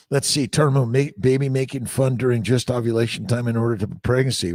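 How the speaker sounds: normal talking voice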